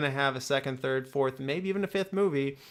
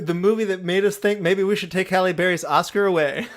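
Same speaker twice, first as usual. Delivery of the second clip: laughing; 4 semitones higher, 6 dB louder than usual. Real, not acted. Joke about Halle Berry.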